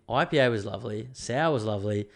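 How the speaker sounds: ordinary delivery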